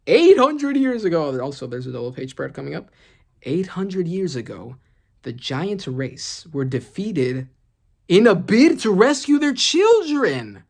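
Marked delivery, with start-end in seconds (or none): 0.1-1.4 dramatic reading. Acted. Dramatic reading of Imu's line. 3.4-10.6 dramatic narration. Acted. Dramatic reading of Imu's monologue.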